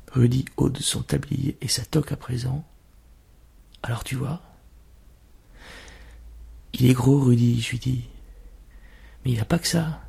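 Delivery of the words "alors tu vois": plain reading voice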